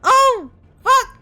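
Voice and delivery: loud, high shocked curse with a laugh; laughing through the shock. Real, not acted. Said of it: "And laugh at my shock".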